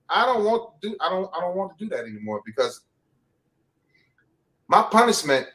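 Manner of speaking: ordinary delivery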